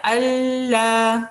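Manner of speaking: अल्लाह stretched slowly in an odd voice; wrong way, dragged out. Shown as a demonstration. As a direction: show the drawn-out अल्लाह as a mistake. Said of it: "इसको ऐसे पढ़ा जा सकता है".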